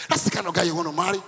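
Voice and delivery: loud, fast punchline about the ideal husband; loud emphatic. Acted. Preacher urging the congregation, loud and fast. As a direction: hit it hard and quick. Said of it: "repeated line".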